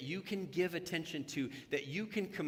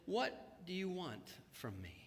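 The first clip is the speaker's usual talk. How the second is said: softer, tender asking; gentle question; 7 dB quieter than usual. Retold or imitated. Jesus asking the beggar what he wants, softly. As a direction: ask him gently.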